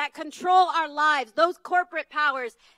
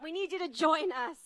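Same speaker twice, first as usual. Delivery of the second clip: ordinary delivery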